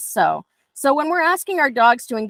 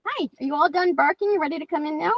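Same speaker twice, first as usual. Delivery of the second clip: talking to the dog; 6 semitones higher, 4 dB quieter, much faster than usual. Real, not acted. Talking to her barking dog.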